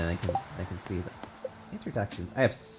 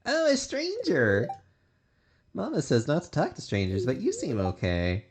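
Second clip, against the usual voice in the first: put-on little kid voice; 4 semitones higher than usual. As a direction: talk up like a small kid. Voicing kid character.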